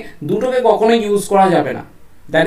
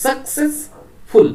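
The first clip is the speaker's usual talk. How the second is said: Successful slow and higher, with a clear break before ফুল; split into syllables; 5 semitones higher, much slower than usual. Shown as a demonstration. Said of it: "then ফুল ওয়ার্ডটা separate". (Successful, থেমে ভেঙে ভেঙে)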